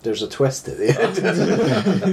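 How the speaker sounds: slow, drawn-out teasing reveal; slow dramatic. Shown as a demonstration. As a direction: stretch each word like a trailer. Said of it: "But there's an incredible twist".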